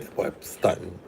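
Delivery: a half-voiced grumble, nearly silent; whispered aside. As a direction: whisper it to yourself, let down. Muttered complaint to himself.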